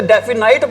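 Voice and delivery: डेफिनाइट with the last syllable rhyming with "night"; mispronounced definite. Retold or imitated. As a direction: say डेफिनाइट with a long "ai" ending, as students do. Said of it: "इसको कई बच्चे बोल". (डेफिनाइट, आख़िर में "नाइट" वाला गलत उच्चारण)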